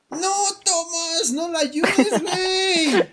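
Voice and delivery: high, loud pleading yell; shouting at a character. Pretend. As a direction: yell a warning at the hero. Mock shouting at story character. (gritando no, Tomás)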